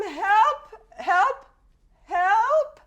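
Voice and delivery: high loud cries for help; yelling for help. Retold or imitated. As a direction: call out for help, pitched high. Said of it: "she kinda yells".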